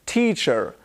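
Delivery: "teacher" with a sounded final r; imitated American "teacher", rhotic ending; American-style r ending. Shown as a demonstration. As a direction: say "teacher" with a hard r at the end. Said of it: "I don't say".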